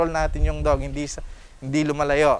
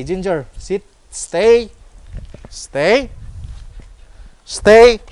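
louder and higher stay; firm dog commands; 4 semitones higher, 7 dB louder, much slower than usual. Real, not acted.

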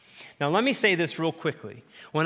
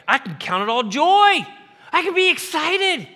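joyful declaration; 10 semitones higher, 5 dB louder than usual. Acted. Confessing joy to the congregation.

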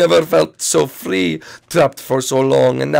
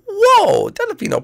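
Mario yahoo; 4 dB louder, much slower than usual. Acted. Mario super jump.

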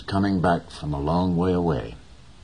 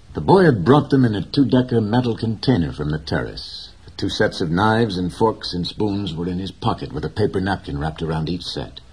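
ordinary delivery, nothing marked

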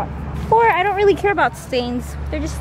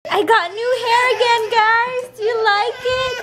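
high cooing voice; 8 semitones higher than usual. Real, not acted. Baby cries at new hair.

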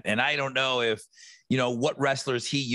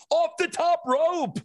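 high, slow wrestling announcer call; 14 semitones higher, 3 dB louder, slower than usual. Retold or imitated. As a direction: boom it out like a ring announcer. Kids announcing wrestling jump.

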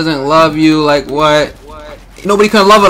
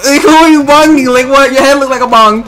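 high-pitched incredulous jeer; indignant comeback; 9 semitones higher than usual. Real, not acted. Player answering a rival's bong taunt, indignant comeback. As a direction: fire back in a raised pitch.

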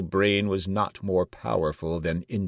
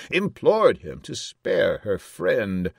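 usual speaking voice

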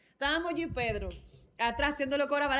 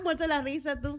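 usual speaking voice

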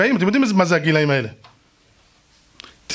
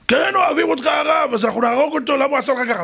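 high, heated shouted protest; agitated crowd; 7 semitones higher than usual. Retold or imitated. Angry crowd answers the rabbi. (צעקות נסערות של חבורה)

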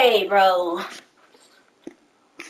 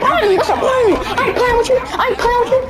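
taunting shout; 7 semitones higher than usual. Real, not acted. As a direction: rub it in his face, laughing. Taunting the rival after revenge.

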